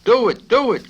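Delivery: very high, loud egging on; eager cry. Acted. Major Metcalf urging the colonel to attack, eager cry. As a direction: cheer him on, thrilled. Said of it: "cried Major Metcalf".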